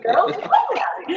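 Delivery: high, giggly aside; whisper-laugh it to a friend. Retold or imitated. Noticing the woman in the window, laughing aside. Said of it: "I'm like".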